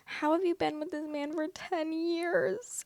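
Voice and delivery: stunned disbelief. Real, not acted. Reacting to the ten-year relationship, high incredulous disbelief. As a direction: ask it in sheer disbelief, drawn out high.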